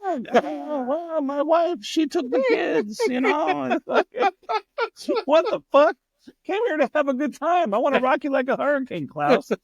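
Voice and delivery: put-on high German-accented whine. Comic mocking [name]'s complaints, high accented whine. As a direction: whine like the aging rock star.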